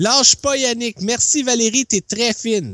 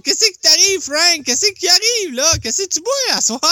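put-on talking to a baby; 8 semitones higher than usual. Teasing caller like a child.

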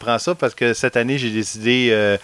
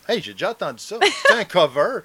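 higher, mock surprised "hey, j'ai déjà entendu ça"; retold surprise; 7 semitones higher than usual. Host retelling hearing the song, mock surprise. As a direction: act out the moment of recognition. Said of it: "je dis".

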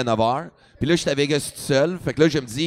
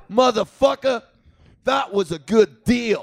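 slow, raised yell of the insult; yelling across the street; 6 semitones higher, slower than usual. Retold or imitated. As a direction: bellow it across a crowded road. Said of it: "Il crie".

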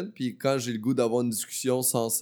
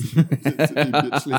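laughing; 6 dB louder, much slower than usual. Real, not acted. Laughing insult.

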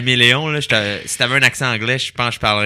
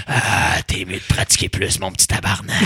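Voldemort voice; 3 semitones lower than usual. Pretend. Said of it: "t'as Voldemort qui vient dans tes cauchemars".

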